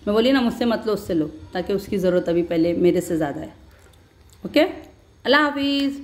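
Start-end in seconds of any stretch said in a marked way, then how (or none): 4.5-6.0 higher-pitched farewell; cheery goodbye. Real, not acted. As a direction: sing out the goodbye. Vlog sign-off to viewers. (ऊँची, खुशमिज़ाज विदाई)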